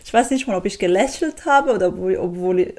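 normal talking voice

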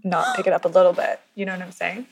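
casual American accent, lightly put on. To the host, who asks why she sounds American, a casual American accent. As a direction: slip into an easy American accent. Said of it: "why are you so good at an American accent".

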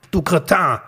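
put-on older man swearing at a blunderer; cursing. A narrator's reading. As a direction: spit the insult out as a curse. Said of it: "fluchte der Mann".

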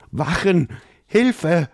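high, loud frightened cry; panicked call for help. Acted. Prince screaming for guards at the creature, panicked.

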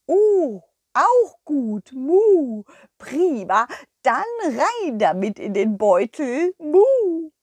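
high, mooing cow with a proud tone; a sweet cow showing off her find; cow puppet. Acted. The cow Kurita proudly presenting her little plate.

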